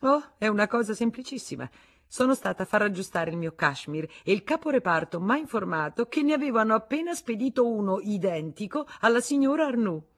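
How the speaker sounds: high, loud, mocking ease; ironic. Wife explaining how she found out, ironic. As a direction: make it sound obvious.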